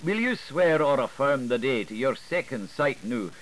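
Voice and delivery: ordinary delivery, nothing marked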